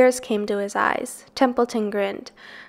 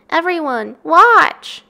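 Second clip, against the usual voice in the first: louder and higher, calling everyone to watch; cried out; 6 semitones higher, 7 dB louder, slower than usual. A narrator's reading. Wilbur calls to the rat, spider and girl to watch, loud cry. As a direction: call out proudly to the whole barnyard. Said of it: "he cried".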